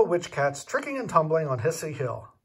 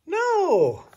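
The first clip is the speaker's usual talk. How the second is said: witch's protest; 14 semitones higher than usual. A narrator's reading. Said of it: "said Sizzle".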